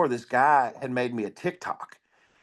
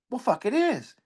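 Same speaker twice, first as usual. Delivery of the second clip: sudden realization; 10 semitones higher than usual. Retold or imitated. His sudden realization quoted.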